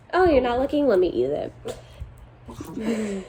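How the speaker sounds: lightly voiced sneaky cat, starting high then slowing down; playful cat voice. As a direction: pretend to be a cat sneaking food. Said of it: "sounds like my cat would do".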